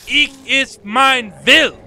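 hard, loud Southern German bark, drawn out; Hitler. Acted. Imitating a speech to show hard Southern German, harsh German oratory. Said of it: "hard, when you hear a Hitler speech".

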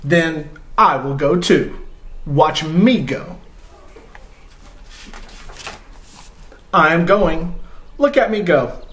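reading a picture book aloud, voicing Gerald; reading to children. Dad reads Mo Willems aloud to viewers with Chloe, storytime voice.

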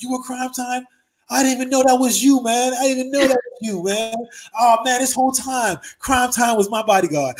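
squeaky boxer stunned and delighted; high excited Tyson. Retold or imitated. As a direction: Tyson realizing his bodyguard was Crime Time. Mike realizing who he was.

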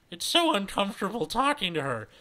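high, disdainful complaint; snide high-voiced gossip; scoffing. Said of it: "she hears Pauline scoff".

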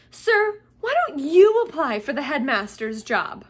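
loud, high-pitched schoolboy address. A narrator's reading. Malfoy urging Snape to apply for headmaster, loudly. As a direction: pipe up loudly for all to hear. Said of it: "said Malfoy loudly".

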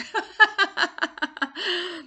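high burst of laughter; laughing at herself. Real, not acted. Coach laughing after tripping on the twister. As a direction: laugh off the fumble. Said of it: "you can have a laugh at me".

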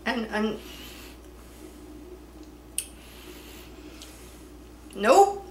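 slightly muffled with food; pausing between chews; chewing while talking. Real, not acted. Seen 0: holds bitten snack, jaw moving, talking between chews throughout.

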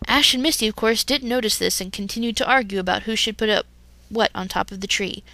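plain reading voice

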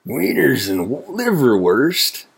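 delighted cry. A narrator's reading. Wolf gets sausages, crying out with relish. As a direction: savor each food word, voice swooping up. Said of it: "cried the wolf".

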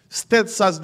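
normal talking voice

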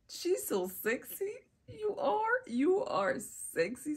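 high, drawn-out hype; admiring praise. Acted. Acted praise of wearer.

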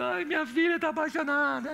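a high, mildly weepy mother's sigh; imitating crying mother. Pastor imitates the bride's mother at the wedding, a mildly teary high voice. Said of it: "a mãe chorando".

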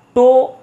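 ফ - টো - গ্রা - ফি with a gap after each; syllable by syllable. Shown as a demonstration. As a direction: count out the syllables of ফটোগ্রাফি. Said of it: "চারটা syllable রয়েছে".

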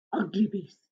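soft repeated words; quiet echo. A narrator's reading. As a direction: say it under your breath. Repeats the phrase softly.

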